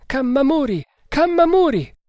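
very high, strained call for help; strangled cry. A narrator's reading. Terrified Bengali calling Kammamuri, strangled cry. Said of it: "ripeté con voce strozzata".

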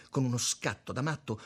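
ordinary delivery

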